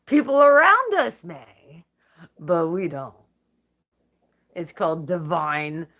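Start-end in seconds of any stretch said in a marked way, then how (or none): 0.0-3.1 louder, slowly, laughing as it starts; laughing smug boast. Real, not acted. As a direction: laugh it out, then land it. Laughing through defiant boast.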